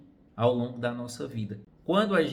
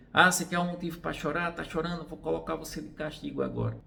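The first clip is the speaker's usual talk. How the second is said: imagined harsh scolding; 5 semitones higher, faster than usual. Retold or imitated. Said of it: "Imagina se eu pego assim".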